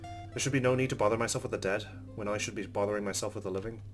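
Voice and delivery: ordinary delivery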